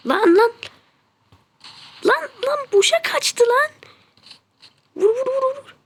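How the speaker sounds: high, loud frantic urging; excited yelling. Real, not acted. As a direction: shout at the screen as the shot slips away. Missed shot, urging sniper fire.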